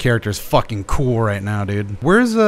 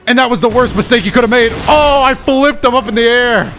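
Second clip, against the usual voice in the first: excited gaming outburst; 10 semitones higher, 4 dB louder than usual. Real, not acted. Gamer hyped mid-fight.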